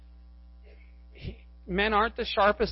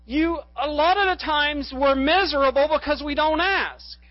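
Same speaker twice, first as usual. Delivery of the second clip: higher, louder sermon voice; raised, emphatic pulpit delivery; impassioned preaching; 7 semitones higher, 6 dB louder than usual. Real, not acted. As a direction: drive the message home to the congregation.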